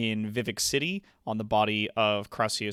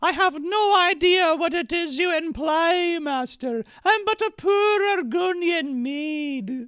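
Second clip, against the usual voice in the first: prim maid voice; 6 dB louder than usual. Acted. Streamer reading the maid's lines, high falsetto. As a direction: squeak it out like a coy, flustered maid. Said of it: "I'm playing both the characters".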